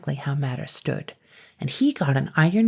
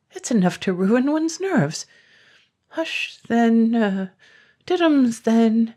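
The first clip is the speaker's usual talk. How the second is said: cooing dragon; 7 semitones higher, 4 dB louder, slower than usual. Acted. Dragon coos at baby.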